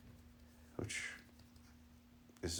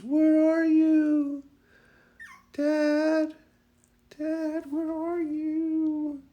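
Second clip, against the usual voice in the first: very high, drawn-out mock howl; loud, slow falsetto dog wail; whining, howling puppy; 8 dB louder, much slower than usual. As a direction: howl the words like an abandoned puppy. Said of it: "she starts whining and howling".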